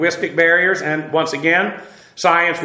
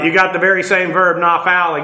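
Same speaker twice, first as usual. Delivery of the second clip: ordinary talk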